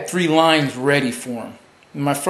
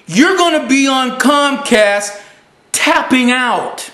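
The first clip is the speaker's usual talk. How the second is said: emphatic taunt; 7 semitones higher than usual. Real, not acted. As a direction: hammer it home at the radio host, pitched well up. Mocking answer to radio host's question.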